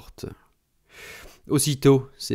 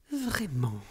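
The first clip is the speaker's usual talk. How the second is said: vieille dame aiguë, plus douce; répéter le mot à mi-voix; répété plus bas; 9 semitones higher, 7 dB quieter than usual. A narrator's reading. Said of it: "Elle répéta". (elle répète sa question, voix aiguë adoucie)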